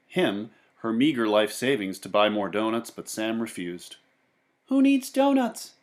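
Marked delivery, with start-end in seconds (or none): 4.7-5.7 put-on higher boyish voice; Sam's reply. A narrator's reading. Sam refusing the old woman's savings, higher voice. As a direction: brush it off lightly as Sam. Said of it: "he said".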